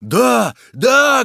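loud, high, electrified cries; crowd shouting. A narrator's reading. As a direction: roar agreement with the mob. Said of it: "кричала толпа".

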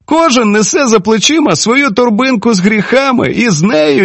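high-pitched, emphatic moralizing; impassioned high-voiced speech to settle a quarrel; peacemaking woman. A narrator's reading. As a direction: plead and reason in a high female voice.

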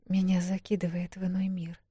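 soft unvoiced whisper, drained of life; lifeless tone. A narrator's reading. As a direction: breathe it out hollow and empty. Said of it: "произнесла она таким безжизненным тоном".

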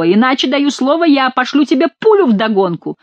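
shouted threat. A narrator's reading. Chicot threatens to shoot after the monk, shouted threat. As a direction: call the threat after the rider. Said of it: "кричал Шико".

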